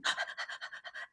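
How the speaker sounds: quick breathy dog pants; eager puppy panting. Acted. Speaker showing the cute puppy, eager panting. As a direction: pant like an excited puppy.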